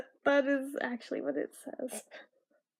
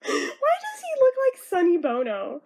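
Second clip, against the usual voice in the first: amused disbelief; 12 semitones higher, 7 dB louder than usual. Real, not acted. Amused at the villain's face.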